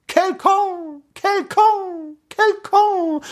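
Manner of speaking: high, ringing echo voice; repeating echo. A narrator's reading. Echo answering the prince in French, high repeated call. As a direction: chime it back like an echo. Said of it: "sagte das Echo siebenmal".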